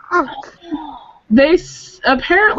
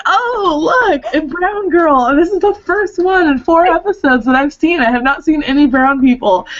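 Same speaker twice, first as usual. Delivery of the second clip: mock amazed discovery; 7 semitones higher than usual. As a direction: exclaim it high, playing up the amazement. Acts out reaction to episode.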